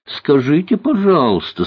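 put-on high, coaxing girlish voice; sweet little voice. A narrator's reading. Girl asks cook for a favour, sweetly. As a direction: ask sweetly, pitched well up. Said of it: "сказала она ласковым голосочком".

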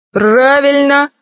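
slow, drawn-out "правильно!"; approving cry. A narrator's reading. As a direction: draw it out with relish. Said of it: "закричал крыс".

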